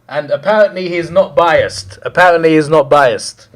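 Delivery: emphatic, incredulous jeer; loud sarcasm. Real, not acted. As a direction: proclaim it loudly in scornful disbelief. Sarcastic repeat of the man's claim.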